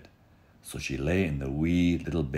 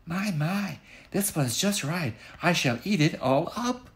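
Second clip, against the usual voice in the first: put-on very high delighted girlish voice; pleased little girl. Goldie finding baby's porridge just right, high delighted voice. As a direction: savour the perfect porridge.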